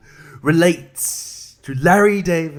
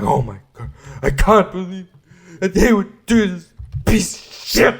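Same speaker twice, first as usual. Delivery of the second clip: mock outrage; 5 semitones higher, 5 dB louder than usual. Mocking the offended Facebook poster with hammed-up outrage. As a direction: ham up the offended critic. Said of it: "acting like".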